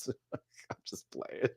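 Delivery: breathy whisper through a laugh. Real, not acted. Vlogger laughs and whispers that she is kidding.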